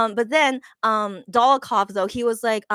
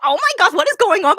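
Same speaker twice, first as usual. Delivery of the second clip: high, loud astonished exclamation; amazed outburst; 9 semitones higher, 8 dB louder than usual. Retold or imitated. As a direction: relive the shock at full pitch. Reenacts amazed reaction to twirling.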